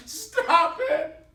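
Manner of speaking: high, tearful plea; distraught cry, pitched far up; anguished outcry. Acted. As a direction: cry out helplessly to make it stop. Brother cries out at the nailing.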